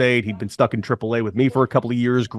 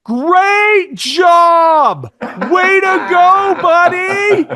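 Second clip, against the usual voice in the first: high, loud, slow cheer; loud praise; 7 dB louder, much slower than usual. Retold or imitated. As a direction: cheer him like a proud teammate. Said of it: "He's like".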